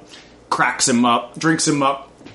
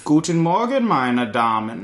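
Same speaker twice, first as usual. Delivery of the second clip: put-on German accent; 3 semitones higher than usual. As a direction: greet them like a German villain. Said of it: "increasingly more German".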